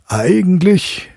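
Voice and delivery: high, drawn-out musing; father considering the plan; thoughtful father. A narrator's reading. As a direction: weigh the idea slowly. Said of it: "sprach der Medizinalrat".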